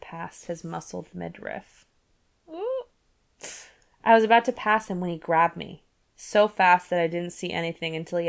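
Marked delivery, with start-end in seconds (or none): none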